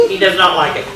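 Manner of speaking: usual speaking voice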